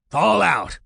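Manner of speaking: sudden high, gruff command; loud gruff yell. A narrator's reading. Gruff voice yells at recruits on the bus, loud barked order. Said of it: "loud, gruff voice immediately yells".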